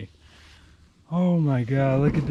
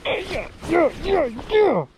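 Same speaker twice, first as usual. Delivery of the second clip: high-pitched yeahs. Real, not acted.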